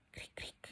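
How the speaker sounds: unvoiced "cric, cric" clicked out like crickets; cricket chirp. Shown as a demonstration. Teacher reading the class exercise, cricket chirps whispered. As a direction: breathe the chirps into awkward silence. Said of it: "es como el sonido de los grillos".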